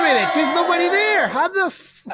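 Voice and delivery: high-pitched mock disbelief, called out; incredulous outburst. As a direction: cry out as a baffled listener. Acted reaction to empty stadium noise.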